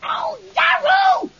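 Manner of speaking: slow, high terrified yell. Acted. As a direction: yell in blind panic.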